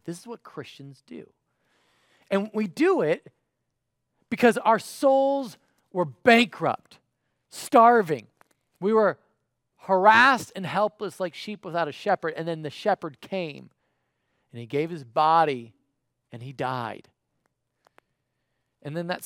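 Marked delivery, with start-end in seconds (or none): 2.3-9.2 loud, slow, soaring sermon climax; full-force preaching. Acted. 9.8-12.3 loud, ringing sermon line with weight on harassed; full-force preaching. Acted. 12.3-17.1 loud sermon line falling to a close on died; preaching. Acted. Preaching, louder, landing on died.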